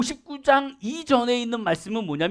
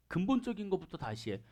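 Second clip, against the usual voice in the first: 근본적인 것부터 다시 해 said again much softer; quiet, firm insistence; soft repeat; 3 semitones lower, 13 dB quieter than usual. Shown as a demonstration. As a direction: drop the voice right down on 근본적인 것부터 다시 해. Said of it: "그러면서 딱 해요".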